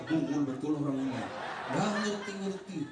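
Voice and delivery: laughing through a fond grumble; laughing exasperation. Retold or imitated. Preacher retells muttering about his clueless guest, laughing. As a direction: chuckle while calling him dense. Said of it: "saya bilang".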